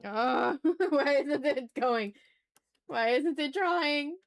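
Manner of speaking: usual speaking voice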